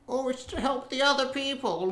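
high, mocking excuse voice; mocking quote. Mocking the grandma's excuse in a high voice. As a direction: sneer out her excuse in a put-on voice.

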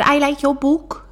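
high, loud mock Geordie; imitating a Newcastle accent, excited. Love Island winner greets her fan, in a mock Newcastle accent. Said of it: "And she said".

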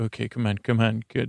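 ordinary talk, nothing marked

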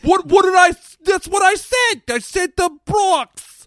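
put-on high, shouted Bronx-accent protest; loud, hammed-up Bronx loudmouth. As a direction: insist indignantly in a broad mock Bronx accent. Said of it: "Is this a good Bronx accent?".

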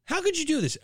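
high, disbelieving repeat; outraged plea. Real, not acted. Host repeating it to the brewery, stressed outrage. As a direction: hit COULD in disbelief.

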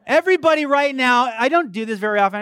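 high, loud, quick call to the crowd; rallying preacher. Real, not acted. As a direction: summon the whole congregation. Calls on the congregation.

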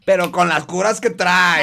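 put-on northern Mexican accent. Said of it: "ese fue tu acento norteño".

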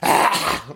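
hacking cough of mock revulsion; disgusted retching cough. Real, not acted. Host revolted by the food pairing, disgusted cough. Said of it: "that's just ectoplasm from the disgust".

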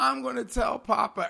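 high-pitched mock kid threat; whiny tattling child. Parent imitating son tattling on his brother, mock whine. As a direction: imitate an aggrieved little tattletale.

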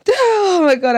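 high, loud burst of dismay; loud, high-pitched outcry; exclaiming. Real, not acted. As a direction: cry out over a lingering stink. Disgusted outburst after joke.

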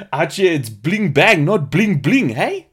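put-on high, loud, swooping South African accent; hammed-up South African bravado with rising tag; Leonardo DiCaprio. DiCaprio at the bar, imitated in exaggerated South African accent. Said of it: "and he says to her".

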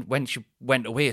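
usual speaking voice

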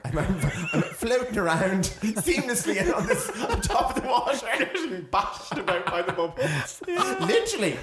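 high, loud telling through laughter; real laughing while talking. Real, not acted. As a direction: struggle to get it out through giggles. Laughing as she describes the jacuzzi.

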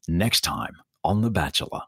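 low promo voice-over; TV promo announcer. Shown as a demonstration. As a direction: announce the next episode in a deep voice. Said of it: "it sounds like this".

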